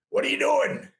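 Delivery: retold yell. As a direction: bark it like the gym owner catching a newbie. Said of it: "I hear him yell at me".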